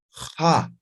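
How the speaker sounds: Ja with a strong throat scrape; rasped ja. Shown as a demonstration. Teacher models rasped x for students. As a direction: rasp the Ja hard. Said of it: "al momento de pronunciar raspen".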